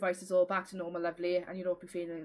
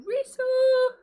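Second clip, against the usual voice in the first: imitating Nikki; 10 dB louder than usual. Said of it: "Nikki's in my chat going".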